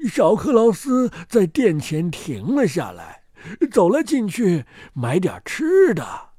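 ordinary delivery, nothing marked

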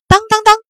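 high, ringing "dang-dang-dang"; loud clanging imitation. A narrator's reading. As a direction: imitate blades striking, very loud. Narrator imitates blades clanging. (高声模仿刀砍的当当声)

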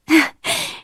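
light little acted laugh; short laugh. A narrator's reading. Sarah delighted by her friend's cake, short laugh. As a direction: give a brief, light chuckle. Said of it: "She laughed".

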